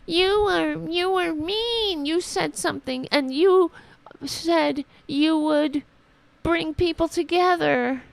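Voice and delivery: high, whiny mock voice of a complaining conservative; mocking whiny right-winger. Host mocking right-wingers in a whiny high voice. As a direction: whine it out like an offended troll. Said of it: "they're like".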